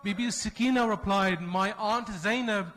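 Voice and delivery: high, loud grieving sermon recitation; mournful lament. Acted. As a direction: wail the tragedy out to the mourners. Majlis lament recitation, rising grief.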